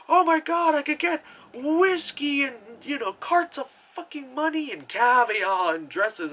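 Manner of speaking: high, gushing mock delight; hammed-up thrilled squeal; mock excitement. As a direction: gush over imagined riches. Husband thrilled by riches, retold.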